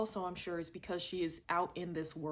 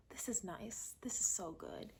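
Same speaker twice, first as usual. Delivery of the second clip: soft, hushed, dreamy whisper; mock contented whisper; 2 semitones higher, 7 dB quieter than usual. Reviewer voicing the audience at the idyllic opening, hushed whisper. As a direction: breathe it out like a lulled viewer. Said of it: "And it's just like".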